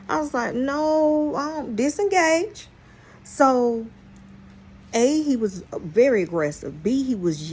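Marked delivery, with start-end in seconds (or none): 0.6-2.7 high, louder, hands-up no; mock backing off. Retold backing off, raised high voice. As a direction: pull away from the man going off at her, pitched-up disengage.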